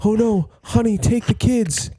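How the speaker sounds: high mock panic, sending the family away; squeaky hammed-up husband; Elios panicking. Farmer telling his wife to flee, high mock voice. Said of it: "Elios is like".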